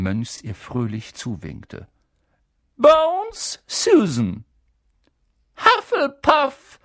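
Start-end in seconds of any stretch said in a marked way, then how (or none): 2.8-4.4 high, loud call across the hall; calling out name. A narrator's reading. Calls out names. 5.6-6.8 high, loud, slow hat voice; the hat proclaims the house again; hat calls out. A narrator's reading. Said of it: "rief der Hut abermals".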